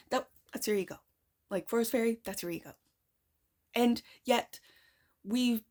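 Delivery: normal talking voice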